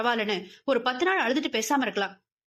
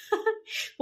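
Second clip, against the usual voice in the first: short laugh; 10 semitones higher, 3 dB quieter than usual. Acted. Laughing on phone.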